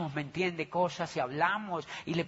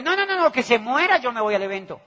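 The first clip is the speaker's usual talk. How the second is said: loud, high, brushing it off with no no no; the stiff guy snapping he's off to the event; mock indignant refusal; 5 semitones higher, 7 dB louder, faster than usual. Stiff engineer, loud and high, refusing the coffee.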